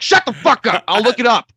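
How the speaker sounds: high, loud mock-angry yell; acted fed-up yelling; exasperated outburst. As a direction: cut them off with a hammed-up shout. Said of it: "didn't do it out loud".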